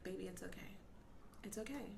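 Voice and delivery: soft, gentle reassurance, retold; soothing. Mom calming her apologetic daughter, soft soothing voice. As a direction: hush it tenderly, well below talking volume. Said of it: "stop and be like".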